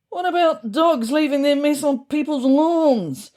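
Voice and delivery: high, loud indignant call from the crowd; loud cry. A narrator's reading. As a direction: shout the complaint across the room. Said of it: "I heard louder cries of".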